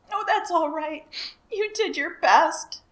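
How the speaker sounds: high, loud tearful comfort; sobbing. A narrator's reading. Elmer comforts the sinking dragon, sobbing. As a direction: sob out the reassurance. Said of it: "sobbed Elmer".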